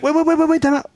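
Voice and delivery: high, hurried wait wait wait; retold pleading interruption; urgent protest. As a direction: cut in urgently.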